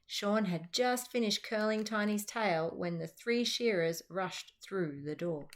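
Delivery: plain reading voice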